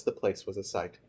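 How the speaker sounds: plain reading voice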